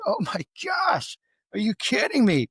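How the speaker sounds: amazed, pitch leaping up and easing down; overjoyed amazement. Retold or imitated. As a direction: cry out in happy disbelief. Retold amazement at friend quitting drugs.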